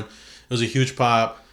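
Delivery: ordinary talk, nothing marked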